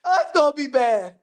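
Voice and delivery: ordinary talk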